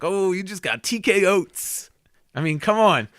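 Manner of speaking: high, hyped boast. Pretend. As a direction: boast it with swagger. Hyped imagined knockout quote.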